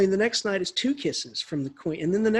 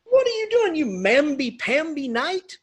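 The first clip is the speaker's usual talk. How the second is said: high, loud singsong jeer; hammed-up mockery of a coward; merciless mocking; 9 semitones higher, 5 dB louder than usual. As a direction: draw out the taunt, pitched way up. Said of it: "makes fun of him mercilessly".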